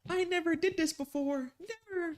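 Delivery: high, hammed-up fake sob voice; badly faked tears to win sympathy; mock crying. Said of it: "I'm gonna start crying".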